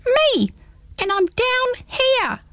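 very high tiny voice from the ground; odd little voice. Acted. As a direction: pipe up like a tiny creature. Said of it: "said the odd little voice".

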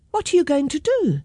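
put-on high, worried-sounding child's question; lightly voiced child asking. A narrator's reading. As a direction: ask it high, like a little girl. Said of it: "asked Gemma".

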